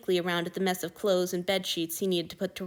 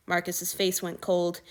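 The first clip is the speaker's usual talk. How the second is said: usual speaking voice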